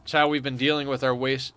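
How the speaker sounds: ordinary talk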